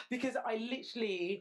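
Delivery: ordinary delivery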